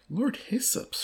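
plain reading voice

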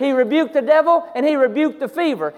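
loud, high, building preacher's cry; fiery revival preaching; preaching at full force. Acted. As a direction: drive the point home to the congregation.

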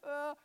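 loud, high mock bawl; short play-acted wail; imitated child crying. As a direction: burst out like a crying little boy. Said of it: "he'll start crying".

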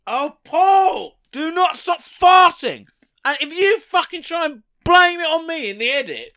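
high, loud hammed-up yelling; mock outraged shout. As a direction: shout in fake indignation. Mock outrage at farting co-host.

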